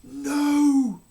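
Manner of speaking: very loud high scream of No. A narrator's reading. Queen being taken away, screaming. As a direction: scream it in despair. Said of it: "screamed the Queen".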